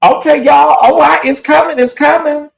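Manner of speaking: loud, high, thrilled announcement; excited outburst. Real, not acted. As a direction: shout it to the chat as the goal nears. Donation goal about to hit live.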